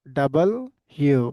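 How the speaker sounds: ডাবল, pause, then ইউ; correct W name. Shown as a demonstration. Teacher drilling the correction, ডাবল ইউ in separate parts. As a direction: split ডাবল and ইউ into two words. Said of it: "কি বলতে হবে?".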